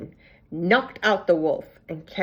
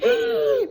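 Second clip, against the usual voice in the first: high gasp; 3 dB louder than usual. Acted. Granny gets gobbled.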